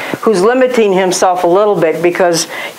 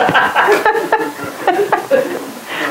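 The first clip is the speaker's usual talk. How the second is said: laughing; 13 semitones higher, 4 dB quieter than usual. Real, not acted. Laughs at own joke.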